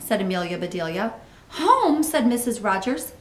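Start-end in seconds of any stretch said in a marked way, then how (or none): 1.5-2.0 "Home" pitched high and loud in disbelief; astonished echo. A narrator's reading. Missus Rogers questioning her housekeeper, "Home" high and astonished.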